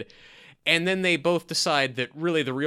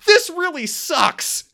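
very high, loud, slow drawn-out exasperated wail; exasperated wife; 10 dB louder, slower than usual. Retold or imitated. Wife turning to husband, echoing the sulky son in high exasperation. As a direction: let it drag out in high comic despair. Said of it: "she turns to Tim and just goes".

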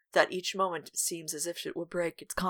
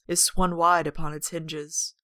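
usual speaking voice

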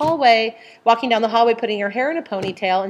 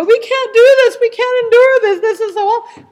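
high, loud wail of despair; panicked earthly self; 13 semitones higher, 9 dB louder than usual. Acted. Earthly self facing the birth pangs, acted panicked wailing. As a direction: cry out that it's unbearable, pitched way up. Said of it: "our earthly self ... go".

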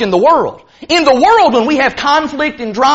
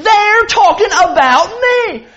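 high, slow, emphatic mock indignation; overly defensive person; 7 semitones higher, slower than usual. Preacher plays a member sure he's discussed, overly defensive. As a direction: draw out the aggrieved suspicion, playing it up. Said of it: "I'm gonna be the overly defensive person".

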